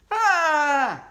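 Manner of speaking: high, loud scream; frightened scream. Acted. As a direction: scream as you're yanked away. Boy screams as grabbed.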